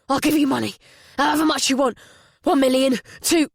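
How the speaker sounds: rising panicked bargaining; desperate pleading. Acted. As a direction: beg frantically, voice climbing. Mark begs for his life.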